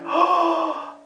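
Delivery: high, sharp acted gasp; hammed-up astonished intake; gasp of realization. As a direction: gasp as if suddenly struck by it. Acts out sudden realization.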